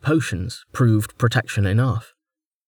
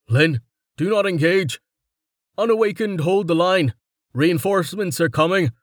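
urgent, raised-pitch command; Samson; 7 semitones higher than usual. A narrator's reading. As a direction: cut through the noise with firm orders. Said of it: "Samson's voice cutting through the clamour".

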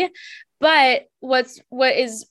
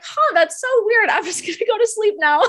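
laughing at herself; 10 semitones higher, faster than usual. Retold or imitated. Oblivious quote breaking into laughter.

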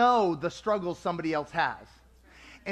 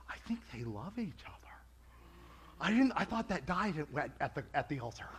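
quick, soft and lower, half to yourself; mock surprised murmur; 6 semitones lower, 10 dB quieter, faster than usual. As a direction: mutter it fast like a stunned spouse noticing. Couples suddenly noticing others' love.

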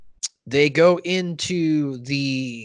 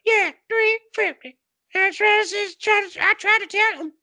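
Chef's mom voice. Retold or imitated. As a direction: chime in from the background. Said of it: "she'll be in the background".

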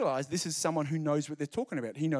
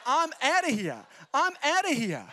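high, loud mock exasperation as a kid; mock teen bolting; 8 semitones higher, 5 dB louder than usual. Kid in mum's scripture class, loud and high in mock exasperation. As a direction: act the fed-up schoolkid storming out. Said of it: "I was like".

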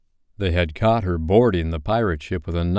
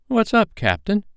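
very high-pitched pirate question; high, inquisitive sailor voice; anxious crewman; 14 semitones higher, 3 dB louder than usual. A narrator's reading. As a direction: pipe up to the captain. Crewman questions brooding captain.

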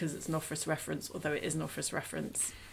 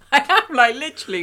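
much higher and louder, bursting into laughter; laughing; 9 semitones higher, 14 dB louder, faster than usual. Real, not acted. Laughing at the film's clumsy line to her co-host, real laughter. Exasperated at film dialogue.

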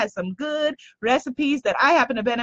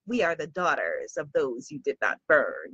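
solemn murmur; 12 semitones lower, 8 dB quieter than usual. Acted. Quoting witch slogan, solemn.

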